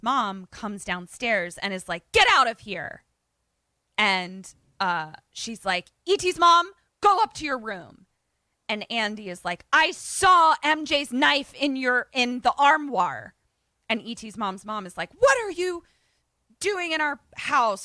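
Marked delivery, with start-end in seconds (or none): 2.1-3.0 mock angry order, louder and higher. Grandmother orders intruder out. 6.0-8.0 high, loud mock scolding; stern parental order. Grandmother voiced ordering daughter upstairs. 9.7-13.3 loud, high, slow mock accusation; heated accusation. Acted confrontation. 15.2-17.8 high-pitched mock outrage; outraged challenge. Grandmother confronts intruder.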